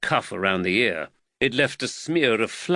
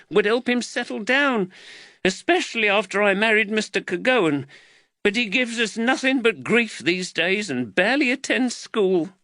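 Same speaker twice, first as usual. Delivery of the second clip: high-pitched, distressed explanation; mother at her wits' end; anguished mother; 9 semitones higher than usual. A narrator's reading. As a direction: pour out the worry in a high woman's voice. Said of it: "Anguish flooded across Jaquetta's face".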